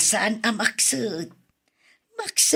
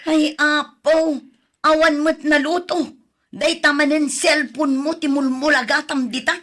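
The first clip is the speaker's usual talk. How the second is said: higher and louder exasperated scolding; angry scolding; 6 semitones higher, 5 dB louder than usual. Acted. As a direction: let the frustration burst out at the phone-addicted grandchild. Grandmother finds nothing cooked. (makapungtot a panangungtot)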